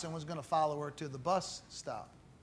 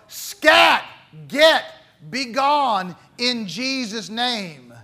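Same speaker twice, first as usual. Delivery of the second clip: commanding shout; 4 semitones higher, 9 dB louder, slower than usual. Pretend. Commanding the devil by faith.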